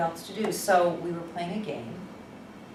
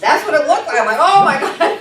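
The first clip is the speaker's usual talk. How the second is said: loud, high laughing "oh my God"; a shriek of laughing astonishment; laughing shock; 11 semitones higher, 17 dB louder than usual. Real, not acted. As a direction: burst out laughing in disbelief. Shocked at possessed-looking girl.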